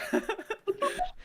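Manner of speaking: shrieking laugh; high squeal of laughter. Real, not acted. Listener squeals laughing at the Venmo story.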